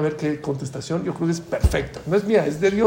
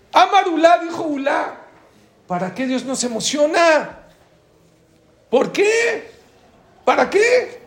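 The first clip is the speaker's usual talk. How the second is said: anguished outcry; 12 semitones higher, 6 dB louder, slower than usual. Retold or imitated. Voicing Ulá's outcry to God.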